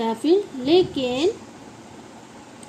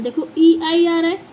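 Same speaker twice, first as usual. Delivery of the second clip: ordinary talk, nothing marked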